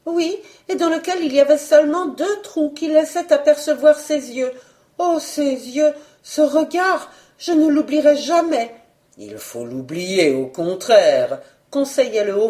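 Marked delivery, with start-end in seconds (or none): 0.0-8.7 put-on high, earnest young woman; lightly voiced maid remembering. A narrator's reading. 9.2-11.4 low, slow official voice; a hint of a grave official; lower official voice. A narrator's reading.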